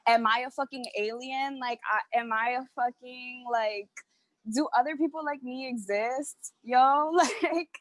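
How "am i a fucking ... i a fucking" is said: ordinary talk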